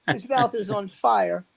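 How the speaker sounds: normal talking voice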